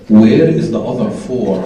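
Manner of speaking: low, slow disapproving father; mock stern dad voice.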